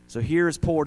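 normal talking voice

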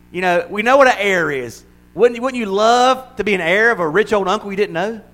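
ordinary delivery

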